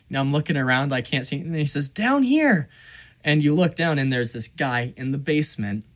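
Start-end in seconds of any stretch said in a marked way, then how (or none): 1.9-2.7 high-pitched imitated call. Kid in the basement to passer-by, calling out. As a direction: pitch it up to get a stranger's attention.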